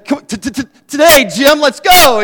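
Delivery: stammered mock start, then a loud impatient yell; goading heckle, climbing high and loud; mocking taunt. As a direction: jeer loudly at a stutterer, high and pushy. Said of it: "I was relentless".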